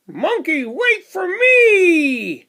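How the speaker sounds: high slow calling shout; the giraffe calling after the monkey, high and slow. Acted. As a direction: call out to the departing train. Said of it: "Giraffe shouts".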